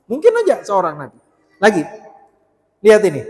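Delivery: normal talking voice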